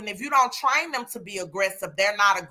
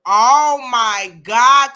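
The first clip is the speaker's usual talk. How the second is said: slow shocked exclaim; 9 dB louder, much slower than usual. Real, not acted. Hears snake eats chickens.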